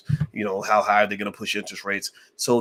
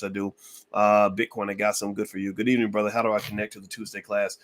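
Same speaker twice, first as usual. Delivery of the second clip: normal talking voice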